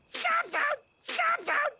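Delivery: high-pitched fed-up shouting; exasperated yelling. Acted. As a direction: snap at your echo, voice way up. Losing temper at echoing self.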